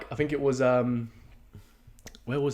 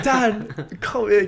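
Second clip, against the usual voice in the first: higher, louder and slow, beaming; proud father; 11 semitones higher, 9 dB louder, much slower than usual. Retold or imitated. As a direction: call him over like a proud dad. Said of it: "I felt like a proud father".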